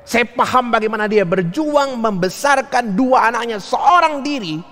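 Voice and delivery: high, rising pitch, slowing at the end; emphatic empathy. Retold or imitated. Pastor recalling a mother's struggle alone, emphatic empathy. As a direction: stretch the final words high with conviction.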